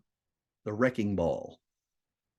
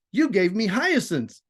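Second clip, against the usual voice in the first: lightly voiced little girl, a touch higher; a hint of a young girl speaking; 6 semitones higher than usual. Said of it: "seven-year-old female voice coming in".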